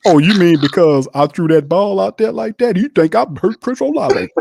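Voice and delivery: high mock-innocent voice; mock innocent Carr. Mocking Carr pretending he just realized, high voice. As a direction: play dumb in a high voice.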